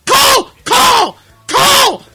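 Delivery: high, very loud repeated shouts; frantic yelling. Retold or imitated. Driver screaming at voice dialing, frantic high shout.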